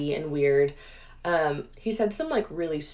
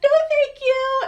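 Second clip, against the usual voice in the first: very high, loud mock-polite squeal; acted high-pitched yelp of refusal; panicked refusal; 10 dB louder than usual. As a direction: shriek a hurried polite no. Retold frightened refusal to the butler.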